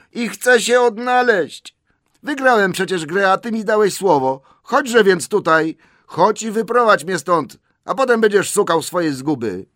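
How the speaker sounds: high, loud, insistent shout. A narrator's reading. Bilbo demands Gollum show him out, shouting. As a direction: yell the demand all the way through. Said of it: "krzyknął Bilbo".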